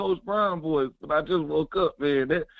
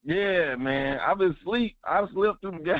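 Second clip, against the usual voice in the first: ordinary talk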